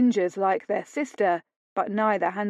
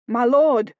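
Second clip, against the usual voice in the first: louder urgent call; 4 semitones higher, 7 dB louder than usual. A narrator's reading. Butler to lord, loud urgent call. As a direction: call across the yard.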